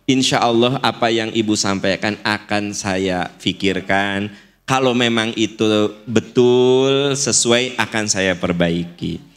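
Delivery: low, composed reply to criticism; elegant acceptance. Shown as a demonstration. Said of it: "Itu lebih elegan".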